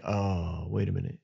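low, soft dawning realization; hushed realization. Retold or imitated. Brother sensing what happened to his sister, hushed. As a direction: let it sink in quietly.

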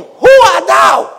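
high, loud challenge; startled demand. Acted. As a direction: cry out in alarm at a stranger. Isaac startled, demanding who is there.

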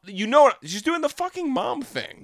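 rising exasperation about mom's nagging; exasperated. Real, not acted. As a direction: voice shoots up, exasperated. Exasperated rant about mom.

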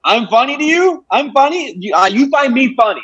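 hammed-up gangster challenge; mobster impression. Playing a tough guy asking if he's funny, pitched far up.

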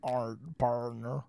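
slow, stretched mock Texan partner; drawled partner. Slow mock Texan partner ending a British line. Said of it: "at the end going, Partner".

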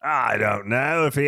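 put-on American putting on an Australian accent. Podcaster asked about the scammer's voice, playful Australian accent. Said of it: "That's the best Australian a-, accent".

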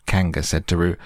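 ordinary delivery

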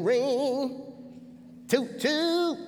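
high, playful sitcom-character voice; drawn-out high mimicked ring and toot; Bruh Man. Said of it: "bruh man voice".

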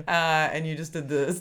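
normal talking voice

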